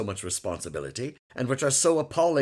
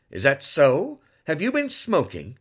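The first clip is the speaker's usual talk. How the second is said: louder firm questioning; stern question; 5 semitones higher, 7 dB louder than usual. A narrator's reading. Father asks son about smoking, stern. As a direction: press him for the truth.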